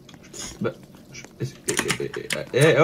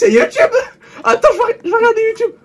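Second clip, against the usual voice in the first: high excited voice; excited squeal; 4 dB louder, faster than usual. Real, not acted. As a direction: squeal with delight.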